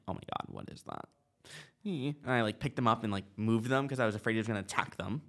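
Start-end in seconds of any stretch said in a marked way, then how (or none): 0.1-1.0 frightened breathless whisper, much lower and softer; scared whisper. Retold or imitated. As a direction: hush it out, spooked by the thing under the step. Discovering animal under doorstep.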